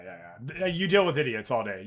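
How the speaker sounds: usual speaking voice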